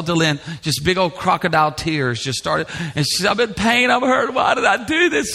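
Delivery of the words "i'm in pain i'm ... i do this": high-pitched pained whine; imitated upset crying. Dad imitating daughter after knee surgery, upset wail. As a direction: act out a daughter wailing in pain. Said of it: "She got so upset".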